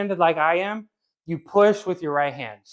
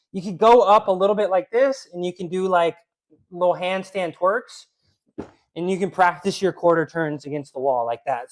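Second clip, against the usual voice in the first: ordinary talk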